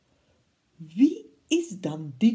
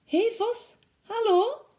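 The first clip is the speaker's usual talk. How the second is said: much higher, brightly called hello; cheery mouse greeting; 14 semitones higher, 4 dB louder than usual. Acted. Mouse greets Snake in front of the Gruffalo, high and cheery. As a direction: sing out a hello to a friend. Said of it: "zei de muis".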